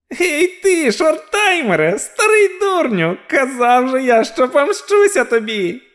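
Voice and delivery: very high-pitched vengeful villain; high, triumphant little creature; gloating dwarf. A narrator's reading. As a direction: taunt your enemy in a high dwarf voice. Gleeful villain dwarf taunts his enemy.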